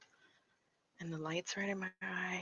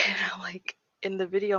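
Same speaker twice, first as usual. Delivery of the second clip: breathy nervous laughing, louder; nervous laughter; 3 semitones higher, 13 dB louder than usual. Real, not acted. Recalling her video reaction, laughing nervously. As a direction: giggle through the fright. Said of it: "I'm laughing 'cause I'm freaking out".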